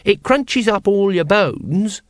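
put-on high, slow child's voice; happy child. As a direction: relish it like a gleeful little child. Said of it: "said the child happily".

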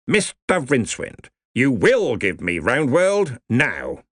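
slow, firm blustering order; commanding old wizard. Acted. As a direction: lay down the law slowly. Ridcully commands.

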